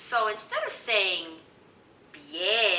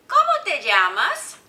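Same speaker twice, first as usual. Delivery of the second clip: loud, higher question with rising end; teacher modelling a phrase; 6 semitones higher, 12 dB louder than usual. Shown as a demonstration. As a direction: project it for children to copy. Models question for learners to copy.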